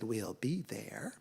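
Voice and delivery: soft, low, near-whispered promise; hushed, slow acceptance; subdued agreement. A narrator's reading. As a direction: give in quietly, stretching the words. Worn-out cats give in quietly.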